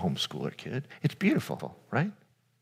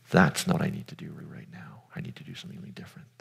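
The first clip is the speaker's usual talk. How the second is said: quiet whisper, fading lower and faster; whispered resolve; 4 semitones lower, 9 dB quieter, faster than usual. Pretend. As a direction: whisper it as a firm private decision. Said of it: "where you can say".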